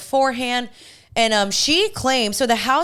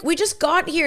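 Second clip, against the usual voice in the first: protesting; 8 semitones higher than usual. Retold or imitated. Retelling her protest to friends.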